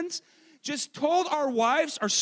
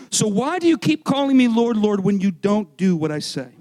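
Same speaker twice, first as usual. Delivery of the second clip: loud, challenging question; forceful scripture reading; 5 semitones lower, 7 dB louder than usual. Acted. Preacher reading Jesus' words to the congregation, forceful question.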